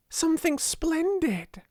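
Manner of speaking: high, thrilled announcement; excited. A narrator's reading. Ermengarde with splendid news for Sarah, excited. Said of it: "said Ermengarde in an excited hurry".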